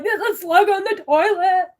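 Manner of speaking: very high, loud mock shriek; panicked yell. Telling sister about the slug prank, panicked shriek. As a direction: blurt it out in exaggerated terror.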